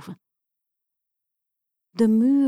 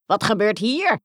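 louder startled witch cry; witch calls out; 4 dB louder than usual. Acted. Witch storms in and calls out louder, alarmed. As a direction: demand an answer, alarmed. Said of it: "riep de heks".

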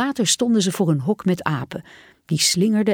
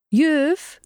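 put-on higher child's call; child calling teacher; 9 semitones higher than usual. A narrator's reading.

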